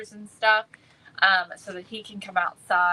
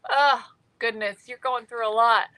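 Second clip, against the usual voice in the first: usual speaking voice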